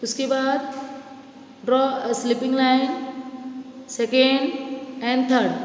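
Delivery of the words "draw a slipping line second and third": put-on each word drawn out for small children; step-by-step, very slowly; slow teacher instructions. Shown as a demonstration. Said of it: "slow instruction to children". (बहुत धीरे निर्देश)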